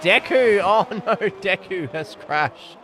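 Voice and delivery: loud, high cry fading into dismay; sudden dismayed live commentary; alarmed crash call. Real, not acted. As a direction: shout the name as the crash happens.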